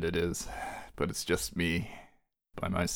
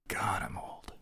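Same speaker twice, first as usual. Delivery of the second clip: mock despair; 2 semitones lower, 10 dB quieter, much slower than usual. Laments being old.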